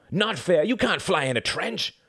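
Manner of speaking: put-on high, indignant boy's outburst; screamed protest. A narrator's reading. Reginald scrambles up to dispute the win, indignant cry. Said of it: "screamed Reginald".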